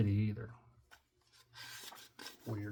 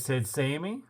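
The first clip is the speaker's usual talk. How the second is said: usual speaking voice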